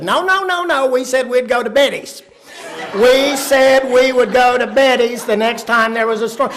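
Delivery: high, insistent objection; protesting mother. Retold or imitated. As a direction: dig in, loudly and stubbornly, as the mother.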